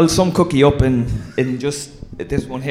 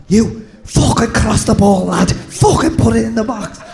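imitating Cookie scolding; 9 semitones higher, 4 dB louder than usual. Teammate imitating Cookie scolding Keano after the loss, high mock rant. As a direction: give out to him in a put-on high sing-song. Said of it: "he'd go to Keano then".

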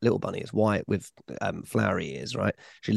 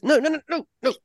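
mock panic. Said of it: "mock horrified refusal".

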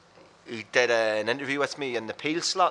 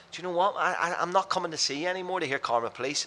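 higher-pitched, telling the band straight; retold blunt declaration; 7 semitones higher than usual. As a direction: put your foot down, pitched up.

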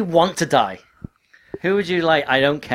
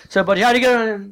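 high-pitched quote; 9 semitones higher, 4 dB louder than usual. Retold or imitated. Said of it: "be like".

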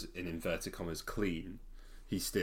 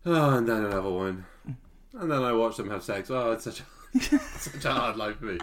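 smug, showy boasting, half laughing; mock bragging; 3 semitones higher, 4 dB louder than usual. Mocking Charlie bragging to the priest, smug and laughing. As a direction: play the lad bragging about his conquests. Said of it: "or is he just full on bragging".

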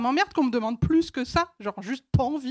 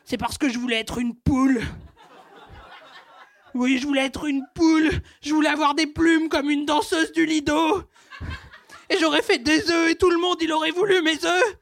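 ovary voice. Acted. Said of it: "j'ai fait parler mes ovaires".

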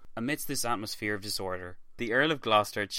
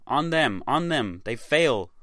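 louder, higher rallying yell; battle cry; 5 semitones higher, 6 dB louder, slower than usual. A narrator's reading. Narrator voicing Scots cries as English waver, louder. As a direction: rouse the army to charge. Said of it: "these cries turned to".

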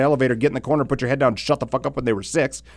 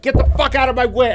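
fed-up snap; 11 semitones higher than usual. Retold or imitated. Finally losing patience.

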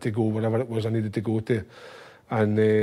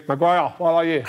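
louder call across the room; mock yell; 5 semitones higher, 6 dB louder than usual. Manager shouting for the culprit, mock yell. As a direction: shout for Maguire, half-laughing.

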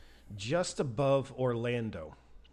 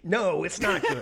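loud, high, laughing exclamation; emphatic agreement; 7 semitones higher, 10 dB louder than usual. Real, not acted. Storyteller answering friend about the failing tire, loud emphatic exclamation. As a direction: burst out loudly with amused alarm.

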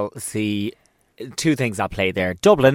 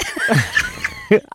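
laughing; 6 semitones higher than usual. Real, not acted. Laughing at voicemail joke.

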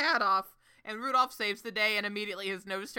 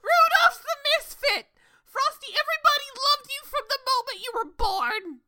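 higher and louder, protesting as Rudolph; mock outraged shout; 8 dB louder than usual. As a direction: shout it up high, insisting. Rudolph loudly contradicting Frosty.

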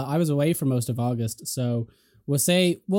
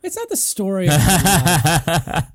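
laughing; 4 semitones higher, 6 dB louder, much slower than usual. Acted. Mock-offended reply with laugh.

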